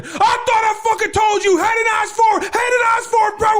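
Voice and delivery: screaming right in someone's face, very high; furious drill sergeant. Retold or imitated. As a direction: rage at a recruit nose to nose.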